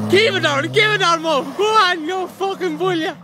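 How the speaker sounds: high, loud egging on; shouted urging. Real, not acted. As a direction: yell at him to floor it.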